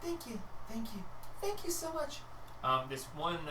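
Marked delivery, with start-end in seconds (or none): 0.0-2.2 grateful villager. Acted.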